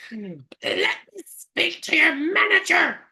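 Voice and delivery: high, slow, entitled Karen whine; Karen demanding manager. Retold or imitated. Mocking Ghislaine as a prison Karen, in a high, slow Karen whine. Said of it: "she's a big Karen in prison".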